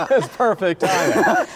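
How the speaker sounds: slow, high words through real laughter; laughing. Real, not acted. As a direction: chuckle your way through it. Host laughing at joke.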